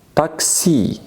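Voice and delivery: Taxi said TAK-si, high pitched; Russian taxi. Shown as a demonstration. Teacher answers her own quiz question, modeling pronunciation. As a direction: give the answer Taxi clearly. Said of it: "how do we call this".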